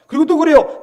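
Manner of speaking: normal talking voice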